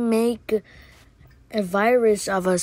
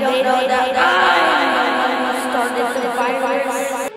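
slow, laughing villain aside; gloating villain; 3 semitones higher, slower than usual. Acted. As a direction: chuckle then savor the secret.

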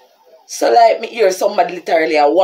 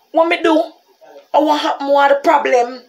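higher, outraged questions; indignant; 9 semitones higher, 4 dB louder than usual. Retold or imitated. Confronting the officer who grabbed her, indignant. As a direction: demand what you did wrong.

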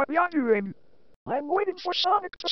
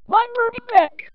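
higher, louder pained yell; 5 semitones higher, 5 dB louder than usual. Acted. Hurt hedgehog yelling about his burning back. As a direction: cry out as the burn stings.

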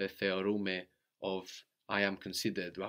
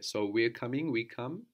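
ordinary delivery

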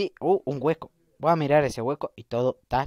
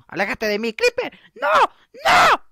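high, rising mock-panicked shouts; alarmed yell; 6 dB louder than usual. Creeper attacks the player.

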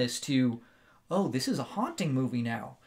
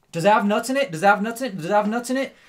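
loud, pressing repeated question; family asking; 6 semitones higher, 6 dB louder, faster than usual. Retold or imitated. Family grilling the girl about nuts, loud and insistent. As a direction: keep hammering the question, raised and higher. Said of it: "everyone in her family asks her".

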